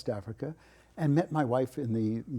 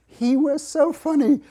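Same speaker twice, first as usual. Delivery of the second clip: amused; 10 dB louder than usual. Real, not acted. Fond laughing memory.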